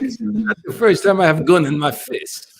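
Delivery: put-on high, slow Croatian accent. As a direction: quote the Croatian stepfather. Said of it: "tell it in his voice".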